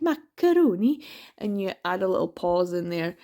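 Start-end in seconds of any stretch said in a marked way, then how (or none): 0.0-1.0 Macaroni in a sing-song Stornoway accent, higher pitch, rising at the end. Shown as a demonstration. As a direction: sing the word Macaroni up and lilting like a Lewis islander. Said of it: "use the word, uh, macaroni to get into the accent".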